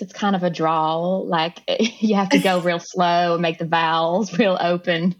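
slow Alabama drawl with wide open vowels; lazy Alabama drawl, taking your time; Southern Alabama accent. Shown as a demonstration. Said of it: "let's hear the Southern Alabama one".

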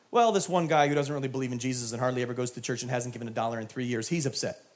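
rattled-off admission. Retold or imitated. Complainer finally naming who is upset, rattled-off low admission. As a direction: rush through it in a low run-on. Acted sheepish answer from complainer.

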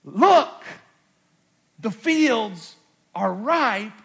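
high, loud, slow, spaced-out command; Jesus urging the disciples, loud and slow; urgent call. Acted. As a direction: stop them in their tracks. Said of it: "Jesus is saying to them".

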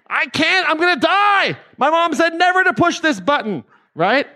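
put-on child refusing to press the forbidden button, frightened and high; scared child voice. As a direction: plead like a child too afraid to press it.

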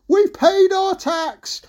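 high, slow called-out reply; mishearing mum. Acted. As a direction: call it back up indignantly. Mum shouts back, mishearing Jack.

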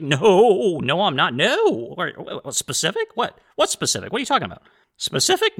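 exaggerated, swooping mock denial; feigned innocence. Host denies hinting at anything specific, hammed-up innocence. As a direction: sputter denials, squeaking up in fake surprise.

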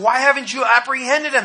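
demanding question. Acted. Priests demand of the guards.

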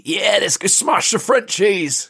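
high, hammed-up gleeful war cry; shaking with joy. Reader plays Andy eager to fight the French, giddy joy. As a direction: cheer it like an eager soldier. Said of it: "He's shaking with joy".

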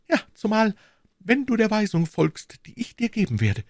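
wife sets out her plan; character dialogue. A narrator's reading. Measured, persuasive.

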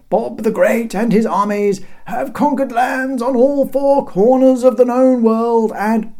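high, loud, stretched-out declaiming; herald's proclamation. A narrator's reading. Herald listing Bob's conquests to the guards, proclaimed. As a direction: project grandly to the ramparts.

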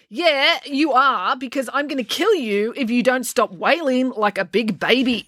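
high, irritated snap at a brother; snapping. A narrator's reading. April threatens her wailing brother, snapping. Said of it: "snapped April".

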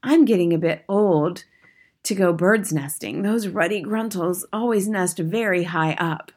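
witch grumbling aside. Acted. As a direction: confide a complaint to a neighbor under the clamor. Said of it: "one witch in the back row saying to her neighbor".

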